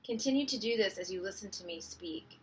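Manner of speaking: soft, unhurried meditation voice with long pauses; hushed, calming breathwork instruction; guided meditation. Real, not acted. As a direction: gently guide listeners into their breath.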